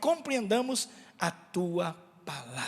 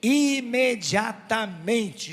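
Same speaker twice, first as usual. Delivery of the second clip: "imediatamente" stretched out syllable by syllable, louder; slow, deliberate "imediatamente"; emphatic repeat-after-me; 4 dB louder, much slower than usual. Shown as a demonstration. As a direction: lead the room slowly through each syllable of "imediatamente". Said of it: "repete comigo".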